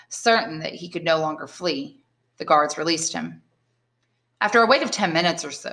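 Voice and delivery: plain reading voice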